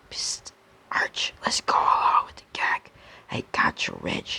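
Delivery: hushed conspiratorial whisper. Acted. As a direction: whisper it behind his back. Secret aside between friends.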